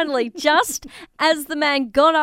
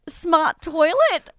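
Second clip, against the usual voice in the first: incredulous; 4 semitones higher, much slower than usual. Real, not acted. Host mocking the story's term.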